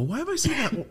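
very high, wondering question; puzzled surprise. Retold or imitated. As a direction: catch it with a start. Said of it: "I go".

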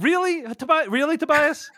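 high playful disbelief at the listener; mock indignation. Real, not acted. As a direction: act scandalized at the listener, jokingly. Teasing indignation at listener email.

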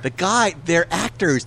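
usual speaking voice

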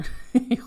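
korte ademende lach. Real, not acted. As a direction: lach kort om jezelf. Lacht om haar leeftijd. (kort lachje)

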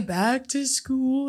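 very slow put-on voice; imitated movie catchphrase. Quoting a line she always says, drawn-out silly voice.

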